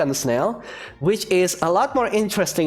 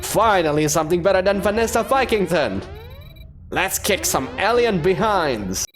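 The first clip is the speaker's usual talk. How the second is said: rally for a fight; a touch of hammed-up Viking bravado; eager Viking warrior; 4 semitones higher than usual.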